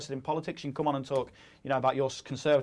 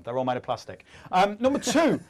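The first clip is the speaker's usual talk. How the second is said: ordinary delivery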